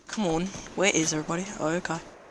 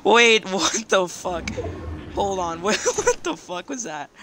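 loud, high, slow shocked cry with laughs; real disbelief, laughing through it; 4 semitones higher, 6 dB louder, slower than usual. Real, not acted. Shocked by strange game event.

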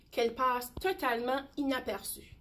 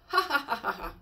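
laugh; faster than usual. Acted. Lion roars with joy.

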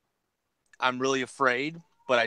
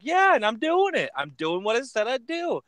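high-pitched, eager mock bragging; hammed-up chummy enthusiasm; acted proud excitement; 11 semitones higher than usual. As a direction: confirm it proudly to an old pal, pitched up. Robber proudly answers old friend.

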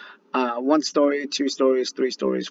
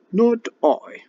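very high, louder cat voice; cat refusing; 12 semitones higher, 6 dB louder than usual. Acted. Lazy cat refusing the hen, very high voice. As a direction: purr it back as the lazy cat. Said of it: "purred the cat".

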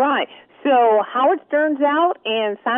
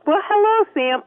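high playful greeting; 9 semitones higher, 3 dB louder than usual. Acted. Host greets puppet in kind.

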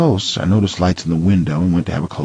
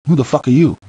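higher, louder hostile demand; angry challenge; 8 semitones higher, 3 dB louder than usual. Acted. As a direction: confront the intruder. Confronting a stranger at gate.

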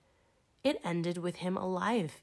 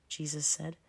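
plain reading voice

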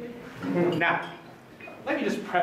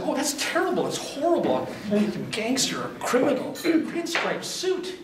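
mock horror; 7 semitones higher than usual. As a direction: ham up the shocked crowd. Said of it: "They said".

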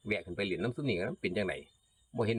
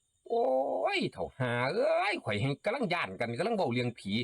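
put-on cursing at the old man; 9 semitones higher than usual. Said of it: "บักเอ็นเห็นหน้าก็ด่าแล้ว".

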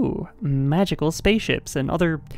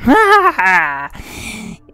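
loud villain cackle; evil laugh; 6 semitones higher, 7 dB louder than usual. Pretend. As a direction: cackle with glee. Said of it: "the Foxy evil voice".

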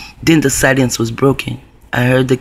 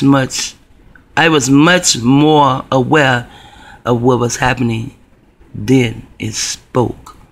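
ordinary delivery

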